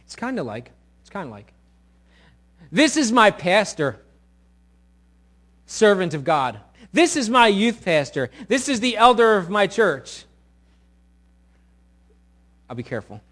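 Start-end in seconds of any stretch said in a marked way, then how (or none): 2.7-10.2 loud, high proclamation, emphatic on each title; proud announcing. Acted. Church member showing off their pastor, loud proud proclaiming. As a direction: announce each one loudly to the crowd.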